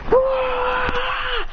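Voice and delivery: long, high sustained scream; high anime power-up yell; acted transformation scream. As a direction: scream through a monstrous transformation. Hero screams while transforming.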